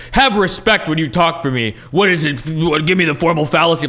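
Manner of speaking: hammed-up alpha-male blustering, pushed loud and high; mocking bluster. Critic mimicking an insecure man demanding respect, mocking bluster. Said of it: "the people that start out with that".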